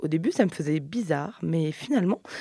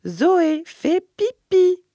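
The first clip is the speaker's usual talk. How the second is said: much higher, louder, slow drawn-out coax; a sister urging Zoé to pee, high, slow and coaxing; big sister coaxing; 11 semitones higher, 6 dB louder, much slower than usual. Acted. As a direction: stretch "pipi" to coax a toddler onto the potty. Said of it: "qu'elle répète".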